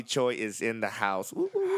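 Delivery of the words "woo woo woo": high-pitched "woo" cheer; cheering whoops. Real, not acted. Welcoming the guest to the show, high cheering whoops.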